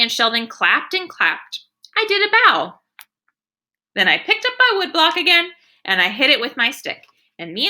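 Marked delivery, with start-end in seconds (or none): 2.0-5.5 high, pleased telling; proud and excited. A narrator's reading. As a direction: show off the little bow. Proud narration.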